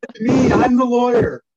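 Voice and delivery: indignant whine of a green lawyer; petulant child. Retold or imitated. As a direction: insist like a stung young brat. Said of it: "this petulant, you know, child".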